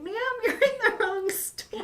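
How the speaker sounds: high, slow mock clerk voice with a laugh; mock snooty saleslady. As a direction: play the saleslady as a sneering joke. Mocking the saleslady again.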